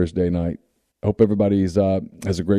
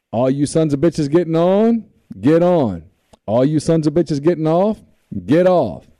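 higher, calling-out conductor voice; train conductor call; 7 semitones higher than usual. Retold or imitated. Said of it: "he pulls it up".

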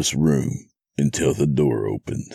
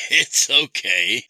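slow, high villain chuckle; amused, with a laugh; 10 semitones higher than usual. A narrator's reading. Captor reassures the horrified watchers, laughing. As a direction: let a laugh lead into it. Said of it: "said with a laugh".

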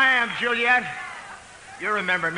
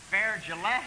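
usual speaking voice

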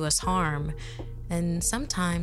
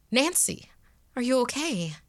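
higher, alarmed calling out; concerned parent; 8 semitones higher than usual. Acted. Parents running in after a scream, concerned. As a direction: check urgently on a child.

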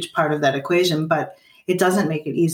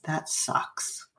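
flat sympathetic whisper; 2 semitones lower, 11 dB quieter than usual. Retold or imitated. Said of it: "You may just say something like".

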